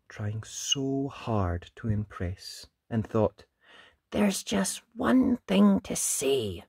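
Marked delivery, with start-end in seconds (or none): 4.1-6.6 high, slow, measured line; Ned's announcement. A narrator's reading. Ned answering the dragon, high slow voice. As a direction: draw it out before the answer. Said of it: "he told the dragon".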